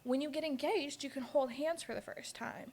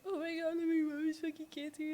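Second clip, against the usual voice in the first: high, soft mock sobbing; hammed-up weepy plea; mock distraught; 6 semitones higher, 3 dB quieter than usual. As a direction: wail it in a put-on tearful whine. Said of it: "down horrendous".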